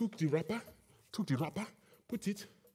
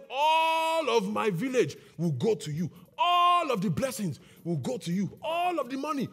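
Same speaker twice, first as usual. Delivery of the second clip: high, chanted proclamation; grand blessing; 7 semitones higher, 3 dB louder than usual. A narrator's reading. Chief blessing his son with the whole village, grand proclaiming. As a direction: draw out each ALL as you bestow the blessing.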